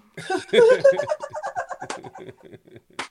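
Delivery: long, high laugh; real laughter. Real, not acted. Guest recalls telling boys she raps better, laughing. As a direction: burst out laughing at your own boast. Laughs at her own boast.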